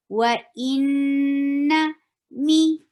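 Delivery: Wa inna mi very slowly, nasal nn, long i; slow recitation. Shown as a demonstration. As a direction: draw out Wa inna mi. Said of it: "Every kasra has double E sound".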